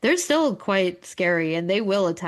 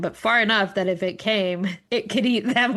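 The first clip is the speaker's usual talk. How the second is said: usual speaking voice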